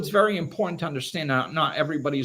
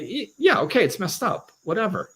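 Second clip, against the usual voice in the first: higher-pitched, mock-exasperated brush-off; dismissive; 7 semitones higher than usual. Answering someone shocked that everything's messed up, dismissive. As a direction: wave it away, pitched up.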